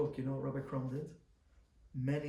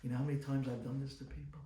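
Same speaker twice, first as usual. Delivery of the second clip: usual speaking voice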